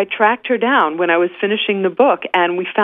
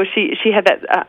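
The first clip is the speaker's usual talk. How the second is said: ordinary talk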